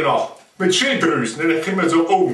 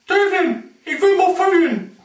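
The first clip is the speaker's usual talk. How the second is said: high snake voice; 9 semitones higher than usual. Acted.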